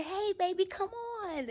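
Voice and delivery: high, very soft coaxing; sweet mama. Retold or imitated. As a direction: coo it sweetly to your child. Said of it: "it was like".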